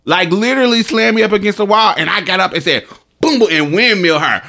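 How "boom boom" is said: high, punchy booms; imitated punches. Telling friends how she hit back, punchy sound effects. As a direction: punch out each boom.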